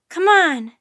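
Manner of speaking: high, forceful call; crying out. A narrator's reading. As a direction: call a companion along urgently. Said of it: "Jack cried".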